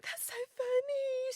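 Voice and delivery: very high, drawn-out squeal of delight; retold amusement. Retelling her reaction to being rolled, amused squeal. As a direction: imitate someone tickled, slow and high.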